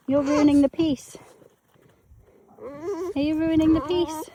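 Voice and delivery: lilting dog-owner voice, drawn out; pet talk. Real, not acted. Chiding her dog in pet talk.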